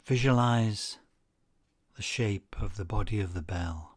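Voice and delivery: unhurried guided-relaxation delivery; guided meditation. Acted. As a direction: lead listeners calmly, phrase by phrase, leaving space after each.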